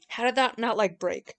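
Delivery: normal talking voice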